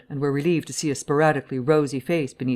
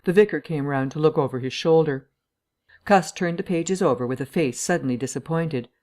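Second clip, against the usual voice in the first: usual speaking voice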